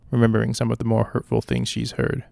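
ordinary delivery, nothing marked

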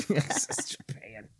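mock whisper of his own mistake. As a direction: hush it like a secret. Mocking his own wrong answer.

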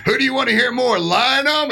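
high-pitched mock crowd poll; festival host on mic. Organizer opening the decision to the audience, high hyped-up call. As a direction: imitate an emcee calling for cheers. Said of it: "I hear him go".

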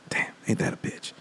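whispered snake line. Retold or imitated. Cursed snake mutters to himself in a whisper. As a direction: whisper it, resigned and beaten.

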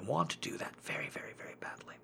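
hushed, barely voiced longing; whispering. Real, not acted. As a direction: breathe it out almost silently. Admits longing, drops to whisper.

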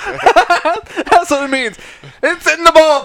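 laughing. Real, not acted. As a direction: laugh while getting it out. Laughing at coworker's spelling.

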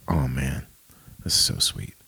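soft, low, mostly whispered; moved whisper. Retold or imitated. Realizing God is a loving parent, soft moved whisper. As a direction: breathe it out, touched.

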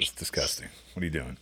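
whispered, voiceless mock disgust. Retelling the beach scene to Todd, whispered mock disgust. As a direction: hiss it under your breath like a shocked friend. Repeats the quote, breathy and soft.

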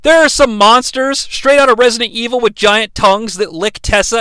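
normal talking voice

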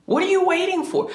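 very high-pitched, cracking cry of frustration; mock desperate yell. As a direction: throw it up high like you can't take it anymore. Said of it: "I remember yelling at God".

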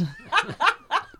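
high-pitched burst of laughter. Real, not acted. Laughs at commercial joke.

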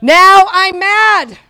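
shouted, slow now I'm mad; loud slow declaration. Shown as a demonstration.

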